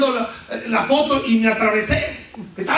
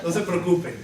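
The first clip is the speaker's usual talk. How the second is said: calm reassurance; 6 semitones lower, 3 dB quieter than usual. Retold or imitated. Pastor calmly reassures sister.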